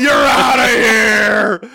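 slow loud umpire shout; umpire call. Pretend. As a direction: bellow it like a referee. Repeats ref ejection call.

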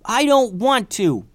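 put-on high, loud, slow child's protest; yelled. Scared boy clinging to the pirate yells down: loud high protest. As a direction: cry out stubbornly, afraid to let go. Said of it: "yelled Kavi".

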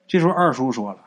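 usual speaking voice

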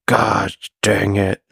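slow drawn-out grumble; slow, frustrated. Acted. Grendon gives up on getting it said, slowly. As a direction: drag out each word in frustration. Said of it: "It hurts when I talk, so I talk real slow".

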